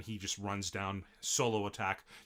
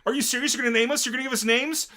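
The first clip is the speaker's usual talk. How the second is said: far higher and louder, disbelieving goblins; amazed high yelps; 14 semitones higher, 11 dB louder, faster than usual. Retold or imitated. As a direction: squeal it in total disbelief. Goblins amazed at being named.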